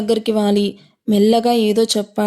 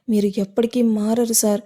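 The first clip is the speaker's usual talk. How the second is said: ordinary delivery, nothing marked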